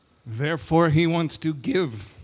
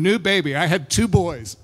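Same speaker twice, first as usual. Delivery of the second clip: ordinary talk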